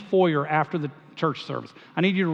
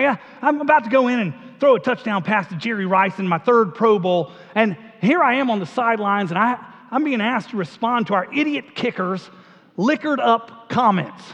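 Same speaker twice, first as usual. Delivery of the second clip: aw shucks Southern Peyton Manning; 4 semitones higher than usual. Retold or imitated. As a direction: play the humble Southern quarterback in a Southern accent. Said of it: "sort of talks like this".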